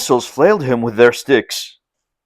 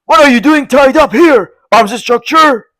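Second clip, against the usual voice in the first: astonished question; 11 semitones higher, 5 dB louder than usual. A narrator's reading. Chai Jin surprised at Lin.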